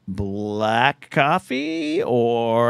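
slow, drawn-out question; imitating the manager. Mimicking the Dunkin' manager, slow and drawn out. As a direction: stretch every word like a bored clerk. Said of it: "finally he goes".

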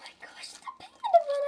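high whispered awe; hushed, high-pitched thrill; excited whisper. Real, not acted. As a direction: whisper it in wonder. Excited over panda bun.

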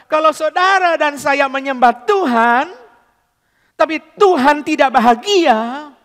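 slow, high and loud, lifting each word; fervent preaching. Acted. Preacher proclaiming to the congregation that God is happy, slow and fervent. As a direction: proclaim with awe. Preacher exalts God's happiness.

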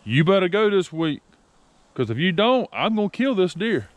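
retold warning. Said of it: "I said, I'm gonna tell you".

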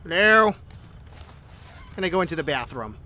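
ordinary delivery

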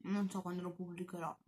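speaking between bites; chewing while talking. Real, not acted. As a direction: mouth partly full. Seen 3: Took a forkful at -4.0; cheeks and jaw still chewing while speaking.

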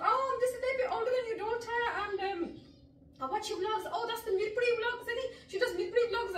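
high, fast gushing fan. Retold or imitated. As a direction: imitate an eager fan. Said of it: "And she goes".

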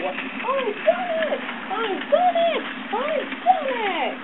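proud triumph. A narrator's reading. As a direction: crow it from the top of the hill. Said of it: "he said proudly".

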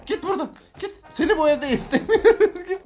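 put-on toddler ordering out. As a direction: play the toddler shooing a grown-up out the door. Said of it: "Bak kovuyor".

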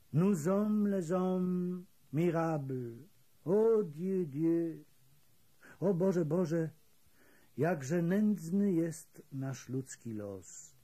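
slow, high, lamenting exaltation; strange exaltation. A narrator's reading. Old Greek raising his hands to the sky, slow exalted lament. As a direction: lift it to heaven like a prophet. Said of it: "w dziwnej egzaltacji".

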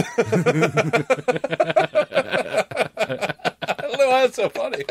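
high voice through helpless laughter; laughing hard. Real, not acted. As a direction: crack up while saying it. Cracking up mid sentence.

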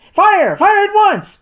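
high, urgent shouted order; urgent cry. A narrator's reading. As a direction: shout a sudden command to shoot. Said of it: "cried the doctor".

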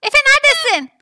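loud, high shouted questions; urgent calling out. Acted. Mother calling to son who found someone, urgent. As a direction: shout across to the searcher.

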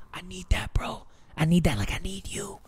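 intense hushed whisper. Acted. Streamer whispers his need for the toy. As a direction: whisper it with longing.

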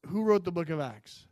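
ordinary talk

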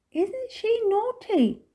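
put-on high, slow child voice; drawn-out, high-pitched telling on someone; tattling child. A narrator's reading. Minx remarking on naughty Lucy, high and tattling.

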